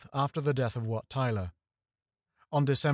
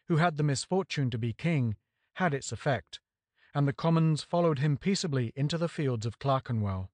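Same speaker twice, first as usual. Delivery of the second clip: ordinary talk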